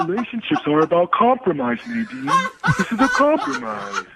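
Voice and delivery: high, goofy cartoon-starfish voice; put-on cartoon dimwit; Patrick. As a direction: reason earnestly as a dopey cartoon character. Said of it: "Can you just talk normal?".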